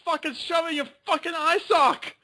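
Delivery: very high-pitched mock-Scottish threat; Scottish accent. Playing the Doctor threatening a Dalek, hammed-up Scottish accent. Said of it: "I can't do a Scottish accent".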